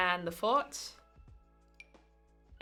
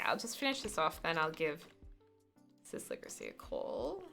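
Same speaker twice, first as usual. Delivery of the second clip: ordinary talk, nothing marked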